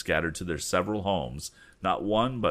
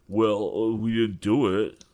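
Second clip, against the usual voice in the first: dry; 4 semitones higher, 6 dB louder than usual. Acted. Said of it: "commented Pete Bailey dryly".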